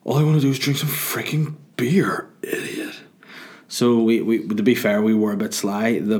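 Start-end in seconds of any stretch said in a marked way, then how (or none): 0.0-3.0 whispered, higher mock whine; Napoleon Dynamite impression. Friends refused beer, mock Napoleon Dynamite whisper. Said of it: "Napoleon Dynamite".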